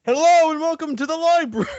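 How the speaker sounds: high, loud, fast announcer greeting; hammed-up library welcome; mock greeter. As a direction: belt it like an overeager host.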